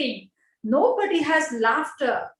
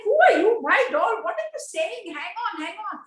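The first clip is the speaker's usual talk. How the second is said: baffled retort; 8 semitones higher than usual. Retold or imitated. School staff reacting, baffled.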